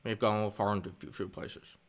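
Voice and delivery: low, soft mumble trailing away; lightly imitated film director, subdued; George Lucas. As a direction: drop the voice and fade out. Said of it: "How about a Lucas impression?".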